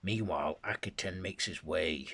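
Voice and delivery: ordinary delivery, nothing marked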